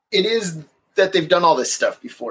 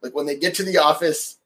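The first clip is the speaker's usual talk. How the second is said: normal talking voice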